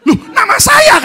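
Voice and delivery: high, loud laughing protest; laughing wife. Retold or imitated. Wife telling her husband it is her own name, laughing loudly. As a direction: squeal it out through a laugh.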